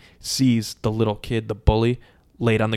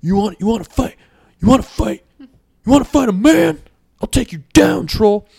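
high, loud fighting challenge; mock blustering yell; trash talking; 11 semitones higher, 8 dB louder than usual. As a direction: pick a fight in a hammed-up shout. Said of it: "So [name] starts trash talking".

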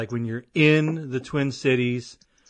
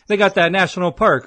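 louder, higher mock-casual dodge; a playful louder bluff, higher up; faking not knowing; 7 semitones higher, 9 dB louder than usual. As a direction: pretend not to know the park's name, louder and higher. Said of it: "Acting like I didn't know the name".

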